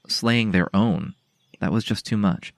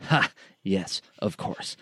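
scoffing bitter laugh, slowing down; 5 dB quieter, slower than usual. Acted. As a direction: laugh it off bitterly, then drawl slowly. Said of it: "The sergeant let out a bitter laugh".

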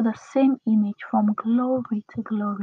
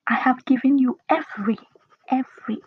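"every" set apart and repeated with weight; EVERY stressed as the key word. Shown as a demonstration. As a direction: land firmly on "every", then pause. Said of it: "emphasis on every".

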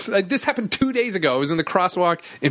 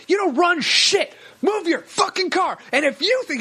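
re-enacted furious yelling; 12 semitones higher than usual. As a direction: yell back at the cabbie, leaning on each word. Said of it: "yelling at a cabbie".

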